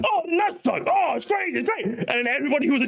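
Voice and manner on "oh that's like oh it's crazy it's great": high, breathless delight; giddy reaction. Retold or imitated. Friends reacting after the Direct, high giddy delight. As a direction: pitch it way up, gushing. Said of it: "And then afterwards".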